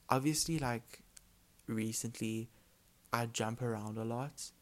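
ordinary talk, nothing marked

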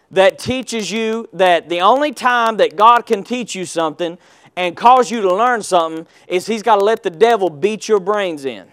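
usual speaking voice

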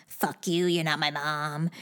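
a sullen mock teen grumbling back; mock bratty kid. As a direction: sulk it out like a resentful stepkid. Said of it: "The kid's gonna go".

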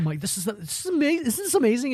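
hammed-up rapturous foodie; gushing fanboy. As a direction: squeal it like he's in food heaven. Tyler gushing about the food.

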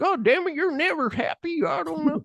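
very high-pitched, grumbling character impression; Stumpy. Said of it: "and Stumpy's like".

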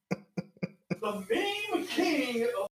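very slow, drawn-out announcer build; mock grand announcement. Hyping Tiger King to Ed like a drawn-out announcer. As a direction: stretch it out like a trailer voice.

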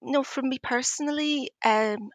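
normal talking voice

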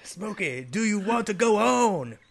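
slow, high, drawn-out questions; mock-dramatic film quote. Host quotes the film's ultimatum to Smokey, mock-dramatic. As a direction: stretch each question out theatrically.

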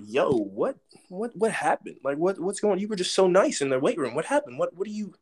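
high, baffled questions, laughing at the end; bewildered disbelief. Retold or imitated. Freshman baffled by the nice coach turned vicious, high disbelief. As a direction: ask her what happened, stunned. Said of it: "I'm like".

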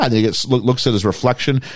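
ordinary delivery

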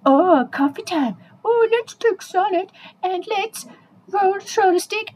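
high, drawn-out delighted cooing; excited. Acted. Dogs announcing a coffee break, high drawn-out excitement. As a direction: stretch it out high with mock delight.

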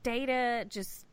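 ordinary talk, nothing marked

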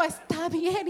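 soft, defeated reply; meek surrender. Retold or imitated. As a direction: give up quietly. El esposo se rinde. (el esposo responde bajito y derrotado)

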